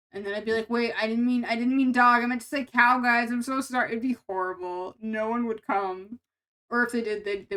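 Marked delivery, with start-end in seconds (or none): 0.7-3.9 louder, hurried backpedal apology to chat; flustered mock correction. Pretend reader apologizing to chat for the wrong word, flustered. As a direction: scramble to fix the blunder.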